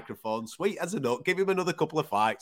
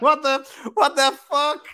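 much higher, loud, slow incredulous yelp; shocked disbelief; 13 semitones higher, 9 dB louder, slower than usual. Retold or imitated. Host reacting to Lewis's mic comment, high and loud in disbelief. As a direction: stretch it out in stunned laughter. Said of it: "And I'm like".

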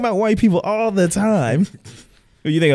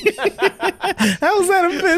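higher, slow, cracking up; laughing through the question; 10 semitones higher, much slower than usual. Real, not acted. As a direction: ask it while helplessly laughing. Laughing disbelief.

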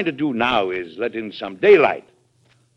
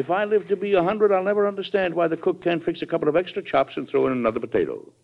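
a high, fast, half-amused exasperated rant; exasperated outburst; 8 semitones higher, faster than usual. Acted. President teasing Miss Sarah about dinner, exasperated. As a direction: throw up your hands, quickly and higher.

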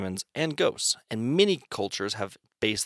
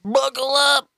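much higher and louder squeal; 7 dB louder, slower than usual. Acted. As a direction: shriek it out playfully. Shrieked repeat of buckle up.

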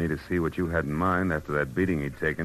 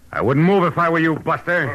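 sharp warning; 11 semitones higher, 7 dB louder than usual. Acted. Gun drawn on intruder.

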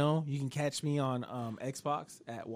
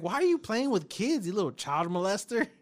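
wife mocking; 6 semitones higher, 3 dB louder than usual. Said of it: "She's like".

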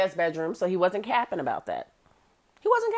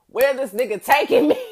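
laughing; 6 semitones higher, 8 dB louder than usual. Retold or imitated. Laughing at own panic.

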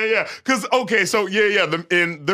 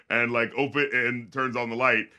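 ordinary talk

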